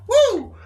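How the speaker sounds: high excited woo; whoop. Real, not acted. Whooping over the yacht life. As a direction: let out a sudden high whoop.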